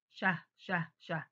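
شه said as a short clipped sound three times; letter sound repeated. Shown as a demonstration. Teacher to pupils, sounding out شه. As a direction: sound out شه briskly for the class. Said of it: "sounding out the letter".

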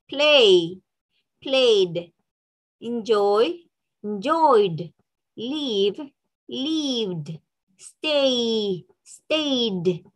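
slow isolated words, -ed as voiced "d"; teacher modelling word pairs for repetition; final d sound drill. Shown as a demonstration. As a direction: stretch and articulate "played", "enjoyed", "stayed". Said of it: "esa E D, ¿cómo va a sonar?".

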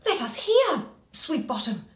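lightly voiced eager Quince, high on the first words. Quince urging Bottom to tell, eager. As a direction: beg him to tell.

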